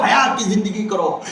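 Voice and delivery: ordinary talk, nothing marked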